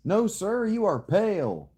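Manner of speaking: high, loud shocked exclamation; loud exclaim. Real, not acted. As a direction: shout it out in shock.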